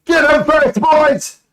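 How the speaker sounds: high, loud yelling guard; startled guard roaring orders; angry guard shout. Acted. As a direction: bellow at the men to attack. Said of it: "He goes".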